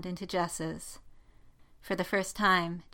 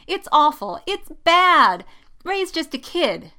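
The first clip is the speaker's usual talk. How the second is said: high, loud, melodramatic wail; lamenting; 9 semitones higher, 10 dB louder than usual. A narrator's reading. Jessica laments liking a younger girl, wailing. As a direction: wail about how hopeless it is. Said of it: "Jessica laments".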